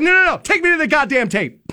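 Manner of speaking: high furious yell, no no no; screaming rant. Retold or imitated. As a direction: yell at her, completely losing it. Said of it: "I'm, like, screaming".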